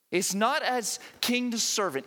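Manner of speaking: usual speaking voice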